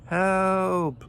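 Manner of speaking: high, drawn-out cry for help; screeched. A narrator's reading. Voice behind the bushes, long high screeched help. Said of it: "screeched the voice".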